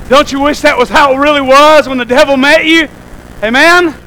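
usual speaking voice

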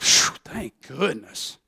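low, slow relieved sigh from the devil. Acted. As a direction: play the devil sighing with relief. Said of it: "the devil went".

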